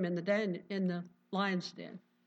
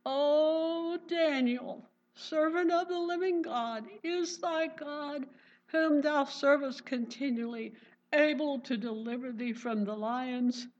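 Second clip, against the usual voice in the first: the king calling down into the den, high and grieving; lamentable cry; 5 semitones higher, 3 dB louder than usual. Acted. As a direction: cry out to Daniel in anguish. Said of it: "he cried with a lamentable voice".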